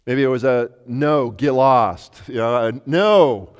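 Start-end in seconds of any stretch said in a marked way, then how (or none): 0.9-2.2 a slow, sour "No, get lost"; grumpy brush-off. Pretend. Tired fishermen brushing off a stranger, slow and sour. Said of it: "how they said that". 2.8-3.5 loud curt no. Pretend. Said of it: "how they said that".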